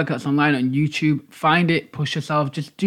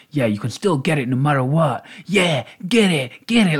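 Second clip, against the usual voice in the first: lightly voiced pep-talk celebrity; mock celebrity trainer. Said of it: "the celebrities that, like".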